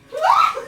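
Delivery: very high, loud squeal of laughter; shrieking laugh. Real, not acted. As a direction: burst into a shrill laugh.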